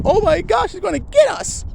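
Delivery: high squealing panic; panicked sheep. Acted. Sheep seeing the wolf, high panicked squeal. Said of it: "they go".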